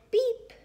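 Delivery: high, short horn beep; little truck beep. A narrator's reading. Blue greets the toad, bright high beep. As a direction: squeak out a tiny beep. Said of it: "Blue said".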